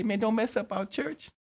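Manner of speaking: high, whiny protest; disciples' protest. Acted. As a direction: beg him not to spoil the church. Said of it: "And they say".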